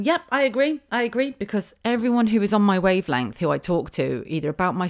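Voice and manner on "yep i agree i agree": high, bright-pitched chiming in; eager agreement. Pretend. Voicing listeners who agree with her, eager agreement. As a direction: imitate a listener readily agreeing. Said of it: "You'll probably be going".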